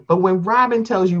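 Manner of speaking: ordinary delivery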